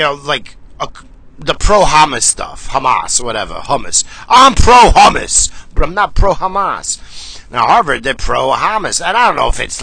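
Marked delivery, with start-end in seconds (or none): 4.3-5.5 mock declaration. Mock claim, then denies it.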